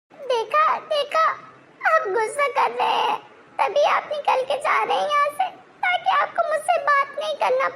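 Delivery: high, sobbing outcry; crying accusation. Acted. Wife crying out as husband walks away, crying accusation. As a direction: wail at him for walking out.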